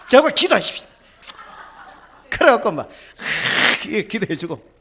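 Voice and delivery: loud pleading with a laugh in it; laughing plea. Retold or imitated. Pastor laughing as he begs the old woman to pray. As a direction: beg her to pray, laughing it off.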